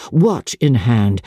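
ordinary delivery

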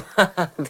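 put-on copycat chuckle; fake laugh. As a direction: laugh along as if you got the joke. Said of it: "Άμα δω ότι γελάνε και διπλανοί μου".

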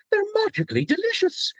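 the cereal mascot's sing-song line; Lucky the Leprechaun voice. Shown as a demonstration. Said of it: "they wanted Doug Prees".

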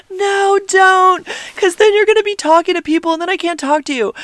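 put-on high-pitched teenage protest; imitated pleading son. Mom imitating son objecting to her livestream, pleading. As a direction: plead high and loud, then rush the reason. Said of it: "And Owen's like".

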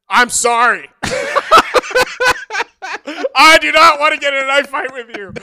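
high, emphatic shout of surrender; retold loud apology. Chef apologises to knife fighter via translator, exaggerated shouting. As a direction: declare it loudly, hamming up the apology.

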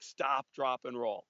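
normal talking voice

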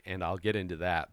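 ordinary talk

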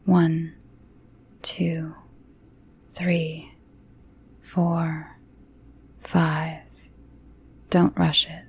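slow soft counting whisper; calm grounding exercise. A narrator's reading. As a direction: count the breaths gently and slowly. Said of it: "her therapist's calm voice".

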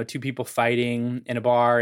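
ordinary talk, nothing marked

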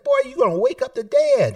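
grandma scolding. Retold or imitated. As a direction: play grandma telling him off, loud and high. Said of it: "She said".

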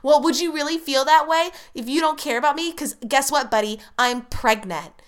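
lightly imitated indignant sitcom girlfriend; Kelly from The Office. Girlfriend confronting Ryan with pregnancy, lightly mimicked indignation. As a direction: start high and challenging, then ease down. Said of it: "Mindy Kaling's character, is like".

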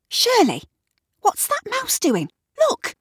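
very high, alarmed call; urgent alarm. A narrator's reading. Kat points Shirley to the mouse, urgent. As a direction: get someone to look right away.

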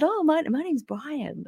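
high, slow imitated American; American accent. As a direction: introduce yourself in a put-on American accent. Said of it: "I'm gonna drop the American accent now".

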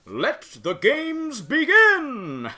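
loud, high, drawn-out proclamation; grand opening-ceremony call, stretched long; sonorous announcer. Acted. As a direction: boom it out slowly over a crowd. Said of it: "just a deep, sonorous voice".

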